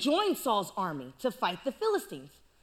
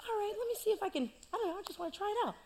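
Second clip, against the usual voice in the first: quick, soft, higher offhand voice; lightly mock casual kid; casual David; 4 semitones higher, 6 dB quieter, much faster than usual. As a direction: toss it off like it's nothing. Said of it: "David's like".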